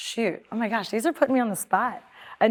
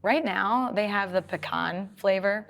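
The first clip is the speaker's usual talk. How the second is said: ordinary delivery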